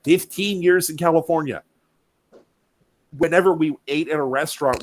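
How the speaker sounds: high and loud, boasting; proud explanation. Retold or imitated. Diner to the waiter, proudly explaining his love of spice. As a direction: brag about it with gusto. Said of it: "I'm like".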